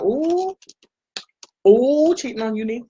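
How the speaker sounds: high, playful "ooh, cheating on you"; teasing high mock voice. Teasing Nick about using another pencil, in a high playful voice. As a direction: tease it in a high sing-song voice. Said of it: "I was like".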